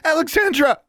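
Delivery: very high, loud falling cry; alarmed, insistent name call; urgent calling. A narrator's reading. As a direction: rouse your friend urgently. Shaking her friend awake.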